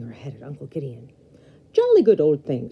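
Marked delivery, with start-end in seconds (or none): 1.7-2.7 loud mock British exclamation. Uncle playing up a British phrase for the Graham, loud mock British. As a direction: put on a jolly English gent. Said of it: "said Uncle Gideon".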